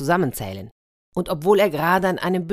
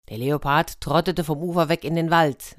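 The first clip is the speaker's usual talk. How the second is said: usual speaking voice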